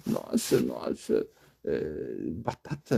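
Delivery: breathless, near-whispered amazement; hushed disbelief at the answer; stunned devotees. Retold or imitated. Devotees gasp at the guru's answer, hushed and amazed. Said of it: "ficavam assim".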